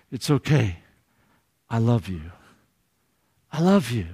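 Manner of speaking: put-on soft, hushed words to a hurt child; whispered comfort. Mother comforting her crying child, softly hushed. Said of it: "whispers into her child's ear".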